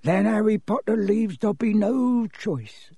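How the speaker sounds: high, slow, sorrowful elf; high-pitched, regretful elf; sad house-elf. Acted. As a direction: say it sadly in a small creature's voice. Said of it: "said the elf sadly".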